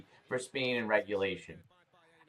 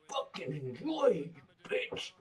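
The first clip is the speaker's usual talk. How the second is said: quiet aside; 7 dB quieter, slower than usual. Acted.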